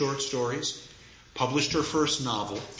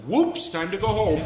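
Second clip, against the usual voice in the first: high, loud mock-casual God; light comic God; 9 semitones higher, 5 dB louder than usual. God calling the writer home, lightly comic voice. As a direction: toss it off as a comic punchline.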